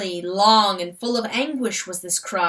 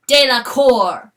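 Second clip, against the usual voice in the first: echoed call; 3 semitones higher, 9 dB louder, slower than usual. Acted. Echoed name.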